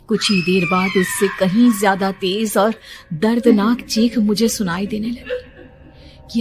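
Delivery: ordinary delivery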